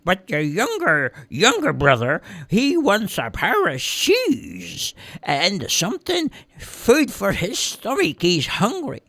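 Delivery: high, slowed dog voice passing on the message. A narrator's reading. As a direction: speak as the earnest rescue dog. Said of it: "Pepper went".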